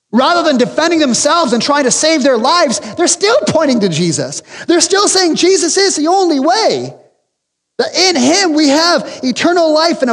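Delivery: fervent pulpit voice; preaching at full force. Acted. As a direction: build the point toward Jesus. Preaching run builds again.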